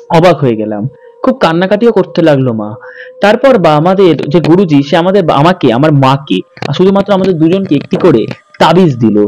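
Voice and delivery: usual speaking voice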